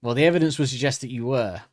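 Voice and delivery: ordinary talk, nothing marked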